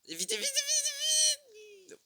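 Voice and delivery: high, loud, slow stretched yell; panicked yell. Real, not acted. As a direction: scream at him to hurry. Urging teammate in panic.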